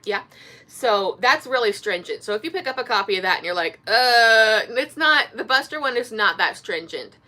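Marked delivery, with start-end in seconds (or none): 3.9-4.6 loud, drawn-out "uhh"; mock a baffled reader's groan; long loud noise of mock bafflement; imitated confused groan. Imagined reader's reaction to dialect.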